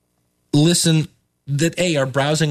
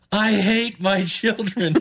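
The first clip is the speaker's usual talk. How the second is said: slow, higher, half-imitated sob of a tired mother; mock sobbing; 6 semitones higher, slower than usual. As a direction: imitate the cousin weeping, lightly. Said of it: "Just weeping and sobbing".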